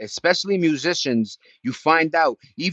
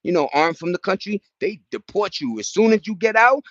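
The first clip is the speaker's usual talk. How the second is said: ordinary talk, nothing marked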